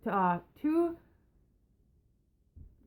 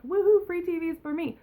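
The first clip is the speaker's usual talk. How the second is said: mock gleeful, higher-pitched whoop; gleeful cheer; 7 semitones higher than usual. Imagined thief grabbing free TVs, mock gleeful. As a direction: put on a delighted finder's cheer.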